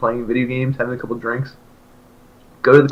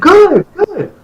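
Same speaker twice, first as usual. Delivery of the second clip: high, loud mocking voice; mock squeaky friend; 5 dB louder than usual. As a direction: parrot the friend's reply. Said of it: "And he's like".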